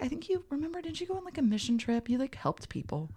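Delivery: high, soft coy voice. Retold or imitated. Playing along with friend.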